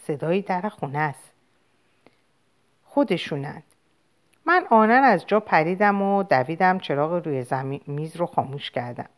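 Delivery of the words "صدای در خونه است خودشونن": put-on a hushed, softer child's voice; very quietly. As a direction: keep it low so the parents won't hear.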